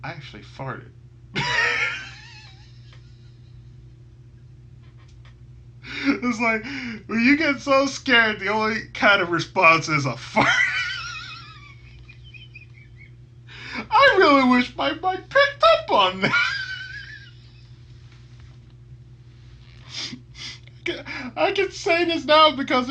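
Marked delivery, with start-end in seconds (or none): 0.9-6.0 howling high-pitched laugh. Real, not acted. Laughing about the fart. 6.0-16.5 loud, high words through laughter; laughing while talking. Real, not acted. Telling viewers about the fart, loud laughing speech. 16.6-21.3 howling high-pitched laugh. Real, not acted. Laughing about the fart.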